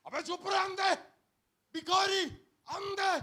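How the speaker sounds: scolding crowd. Acted. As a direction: snap at the beggar to shut up. Crowd voiced scolding blind man.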